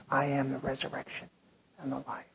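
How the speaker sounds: hushed. Acted. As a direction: speak it gently to those grieving. Same words softly to the grieving.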